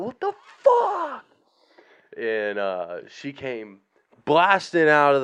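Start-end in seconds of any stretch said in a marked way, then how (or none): 0.0-1.2 high, slow shrieked repeat; repeated high childish yell; kid's scream again. Retold or imitated. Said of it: "Screamed it so loud".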